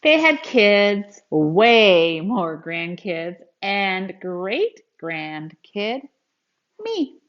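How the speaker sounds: put-on lively, expressive read-aloud for kids; storybook reading. Teacher reading a grandpa story to children, expressive read-aloud. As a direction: read it like a picture book to a class.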